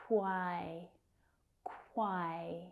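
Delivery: "quai" drawn out with k gliding into w; k-w cluster. Shown as a demonstration. Teacher shows learners the consonant cluster, k-w run together. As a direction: say "quai" with a rounded k-w onset, stretched. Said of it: "we've got this k".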